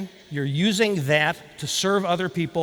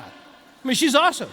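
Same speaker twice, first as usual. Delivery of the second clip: attempted Boston accent, pitched up; best Boston accent; 5 semitones higher than usual. Speaker praising the teller to senior management, attempted Boston accent. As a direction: put on a hammy Boston accent. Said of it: "that's my best Boston accent".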